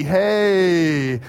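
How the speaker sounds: half-hearted, drawn-out cheer; pity hey. Retold or imitated. Next section humouring the kids, pity cheer. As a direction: give a token hey out of pity. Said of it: "it's kind of a pity".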